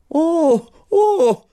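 put-on high, loud delighted old man's cry; teacher's exclamation. Old teacher greets the pupil at the door, high and laughing.

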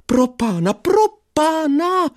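put-on high, drawn-out old man's cry; teacher's alarm. Teacher calls after the fleeing pupil, high and slow. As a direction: wail it like a frightened old teacher. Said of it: "slyšel jsem ho".

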